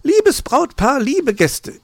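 very high, loud magpie greeting; high-pitched, loud opening to guests; magpie's announcement. A narrator's reading. Magpie takes over the microphone.

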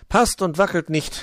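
high, loud and proud; triumphant little elf; proud announcement. A narrator's reading. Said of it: "verkündete er stolz".

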